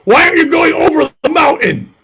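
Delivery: high, loud yell. Retold or imitated. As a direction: yell it like a drill sergeant. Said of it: "yell at him".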